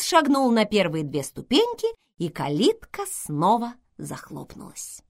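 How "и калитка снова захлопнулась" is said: ordinary delivery, nothing marked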